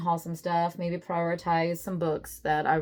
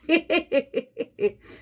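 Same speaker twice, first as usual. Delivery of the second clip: bright burst of laughter at her own excuse; laughing; 9 semitones higher, 4 dB louder than usual. Real, not acted. Vlogger laughing at her own excuse: burst of laughter. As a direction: laugh it off, amused.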